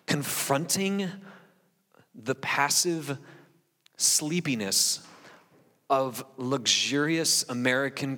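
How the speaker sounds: slow down and hit each word; slow emphatic preaching. Real, not acted. Pastor confronting comfortable Christians, slow and emphatic. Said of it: "emphatic preaching point".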